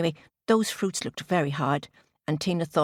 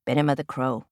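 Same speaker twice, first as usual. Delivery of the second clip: plain reading voice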